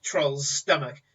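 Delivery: usual speaking voice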